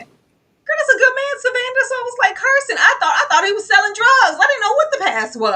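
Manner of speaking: loud, high excited telling. Retold or imitated. Host to co-host, thrilled at Robert, loud and high. Excited retelling of own reaction.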